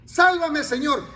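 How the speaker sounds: cry for help. Retold or imitated. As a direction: plead to be saved. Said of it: "un grito fuerte de convicción". (grito fuerte de súplica)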